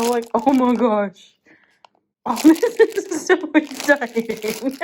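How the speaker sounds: loud, high, giggling exclaims; laughing with excitement. Real, not acted. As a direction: laugh through the words with glee. Laughing while unboxing treats.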